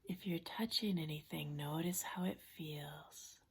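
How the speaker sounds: soft, unhurried relaxation-coach voice with long pauses; guided imagery. Acted. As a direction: gently guide them into a safe place, hushed and calm. Leads a guided imagery exercise.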